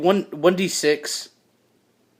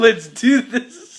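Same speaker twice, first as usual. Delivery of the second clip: slow, loud, higher rally through laughter; amped battle cry; 6 semitones higher, 6 dB louder, much slower than usual. Acted. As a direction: hype it up while laughing.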